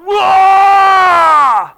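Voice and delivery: loud, high shout of shock; terrified whoa. Pretend. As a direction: gasp it out in sudden terror. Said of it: "kinda went there and going".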